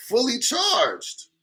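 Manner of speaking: high, very drawn-out exclamation; amazed read-out. Pretend. Streamer reading a viewer's confession, amazed. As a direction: stretch it out high in mock astonishment. Reads shocking comment with relish.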